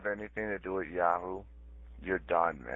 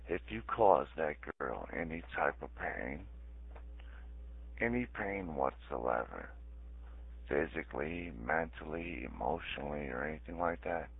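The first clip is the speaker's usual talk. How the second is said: quiet menace; 5 semitones lower, 10 dB quieter than usual. Real, not acted. Measured threat to Rod.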